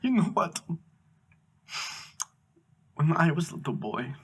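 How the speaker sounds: imitated tearful guest with long pauses; mock choked-up foreign guest, halting; holding back tears. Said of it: "trying to hold back tears".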